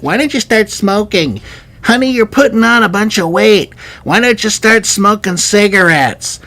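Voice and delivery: high-pitched, put-on nagging voice; mock nagging advice. People urging honey to start smoking, mock nagging. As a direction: pitch it up like someone pushing advice on a loved one.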